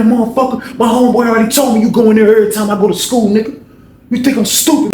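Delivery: high-pitched furious tirade; angry confrontation. Retold or imitated. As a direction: lay into him, high and relentless. Acts old head confronting man.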